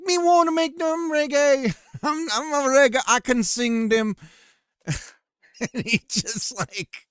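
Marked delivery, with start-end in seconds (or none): 0.0-4.1 much higher, louder fake Jamaican patois; mock Jamaican accent. Streamer retells Snow pitching a reggae album, hammed-up Jamaican accent. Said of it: "And he's like".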